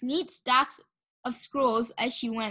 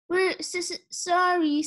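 stammered; 6 semitones higher than usual. A narrator's reading. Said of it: "Sunny stammered".

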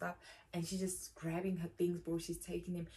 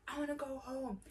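mock shout; 7 semitones higher than usual. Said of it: "She's shouting".

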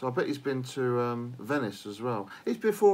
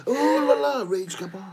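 mock French; 11 semitones higher, 5 dB louder than usual.

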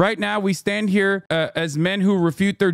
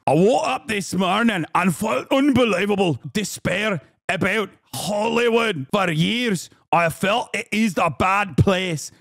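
Scottish accent impression; 2 semitones higher than usual. Mocking Eve Fartlow's post in a Scottish accent. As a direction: read her post in a put-on Scottish accent. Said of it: "I'm doing her accent correctly because remember, she is Scottish".

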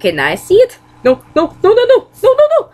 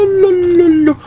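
normal talking voice

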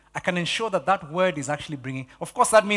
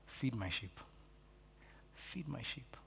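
much softer, lower, gentle command; soft Jesus; 5 semitones lower, 21 dB quieter, slower than usual. Retold or imitated. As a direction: hush it like Jesus answering Peter. Jesus voiced again.

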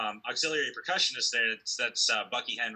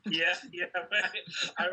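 breaking into laughter mid-word; laughing; 9 semitones higher than usual. Real, not acted. Musician laughing at his matching shirt, laughing yes. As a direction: laugh through the words.

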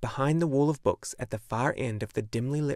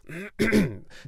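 a gruff throat clear; 9 semitones higher, 4 dB louder than usual. A narrator's reading. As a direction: clear the throat before speaking. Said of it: "clearing his throat".

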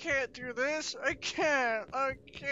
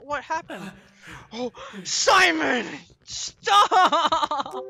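1 frightened yell; 7 semitones higher, 5 dB louder, much slower than usual. Real, not acted. Frightened gamer yelling at monster.

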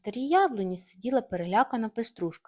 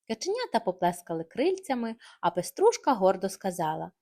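usual speaking voice

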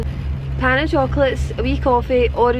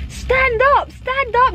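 mimicked shouting; 12 semitones higher, much slower than usual. Retelling a skier girl yelling at her pal, high mock shout. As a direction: squeal it like an impatient girl on the slope. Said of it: "they were pure shouting each other".

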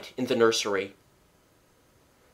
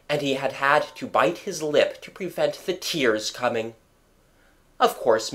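ordinary delivery

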